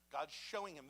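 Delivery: ordinary talk, nothing marked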